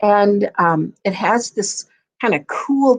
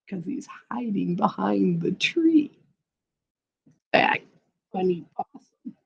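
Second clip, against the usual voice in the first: whispered aside; secret whisper; 7 dB quieter than usual. A narrator's reading. Storyteller confiding to children, whispering.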